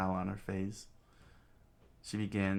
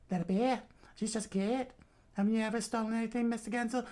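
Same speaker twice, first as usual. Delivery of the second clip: laid-back Southern foster mother, pitched up; Southern accent; faster than usual. Acted. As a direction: defend the kid in high Southern falsetto. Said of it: "pull up my southern".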